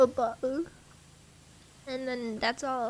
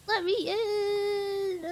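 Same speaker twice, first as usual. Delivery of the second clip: whiny plea; 6 semitones higher, much slower than usual. Pretend. Voicing character outside door.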